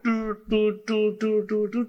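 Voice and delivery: high, evenly repeated "tul, tul"; imitated tapping noise. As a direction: sound out little teasing pokes in a high voice. Demonstrates little teasing taps before cutting.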